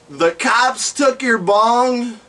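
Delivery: usual speaking voice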